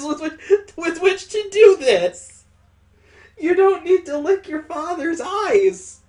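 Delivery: loud, high, disgusted complaint; grossed-out protest. Real, not acted. Reader objecting to licking her father's eyes, grossed out. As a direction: wail in disgust at high pitch.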